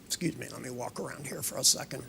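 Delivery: quick whispered aside. Real, not acted. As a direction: whisper it fast so she stays under. Aside while subject is under.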